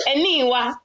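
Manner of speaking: put-on high-pitched mum calling a child's name; imitated motherly summons. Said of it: "I hear my mum go".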